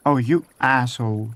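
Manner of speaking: ordinary delivery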